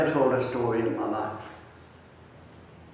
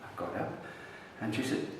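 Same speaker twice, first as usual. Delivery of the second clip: ordinary talk, nothing marked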